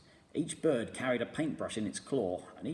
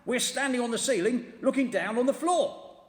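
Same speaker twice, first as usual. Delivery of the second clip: higher, loud, alarmed; frightened and confused; 13 semitones higher, 7 dB louder than usual. A narrator's reading. Mister Twit panicking about being upside down.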